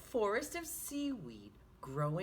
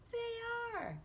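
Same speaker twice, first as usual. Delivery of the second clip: excited; 13 semitones higher than usual. Acted. Delighted answer to own question.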